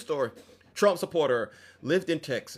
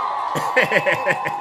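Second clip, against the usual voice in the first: loud, high cackling laugh; real cackle; big laugh; 4 semitones higher, 5 dB louder than usual. Real, not acted. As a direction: burst out laughing. Laughing at joke.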